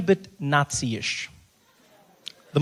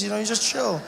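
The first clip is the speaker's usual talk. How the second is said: normal talking voice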